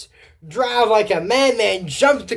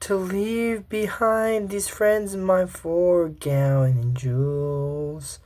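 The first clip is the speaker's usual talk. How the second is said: much softer and very slow, wistful; a little sad; 5 dB quieter, much slower than usual. Retold or imitated. Jade sadly saying goodbye to her old school, soft and very slow. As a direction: drag each word out slowly, sadly. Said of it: "gets a little sad and goes".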